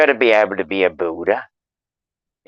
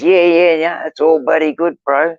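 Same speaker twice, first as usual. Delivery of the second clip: higher-pitched retold brush-off; quick-agreeing mock assurance, pitched up; breezy reassurance; 7 semitones higher, 3 dB louder than usual. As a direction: imitate someone waving away a concern. Mimics the builder brushing him off.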